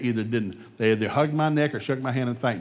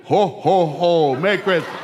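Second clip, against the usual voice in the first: loud, high, booming ho-ho-ho; triumphant comic Santa laugh; Santa Claus; 5 semitones higher, 7 dB louder, slower than usual. Acted. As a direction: bellow a hammed-up holiday greeting. Playing Santa after the rant.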